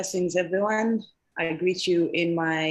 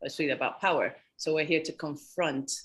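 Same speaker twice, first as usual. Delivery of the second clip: ordinary delivery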